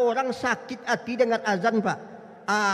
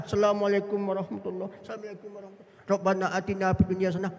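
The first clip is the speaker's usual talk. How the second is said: fast, tumbling rote recitation; mock someone racing through the closing salaam; gabbled on autopilot; rushed prayer ending; 3 semitones lower, much faster than usual. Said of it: "habis semua".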